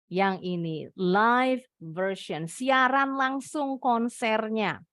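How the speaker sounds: ordinary talk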